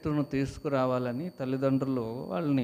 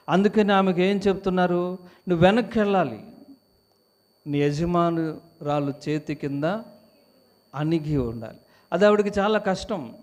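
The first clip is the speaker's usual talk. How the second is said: higher, louder sermon lines; fervent preaching; 4 semitones higher, 5 dB louder than usual. Acted. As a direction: preach with rising force. Preaching at full force. (సంఘానికి ఉత్సాహంగా ప్రసంగం)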